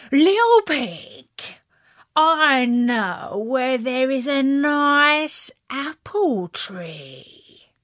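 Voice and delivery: slow, sly coaxing wolf. Acted. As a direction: tempt the pig slyly. Wolf tempts the pig.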